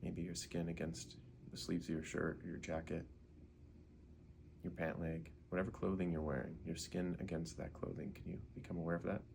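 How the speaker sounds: ordinary delivery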